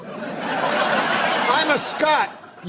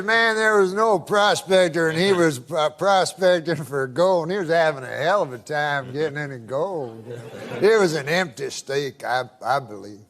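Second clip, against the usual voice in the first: put-on Georgia good old boy spinning a yarn, lower and drawled; Southern good old boy; 7 semitones lower than usual. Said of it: "I can tell by the accent".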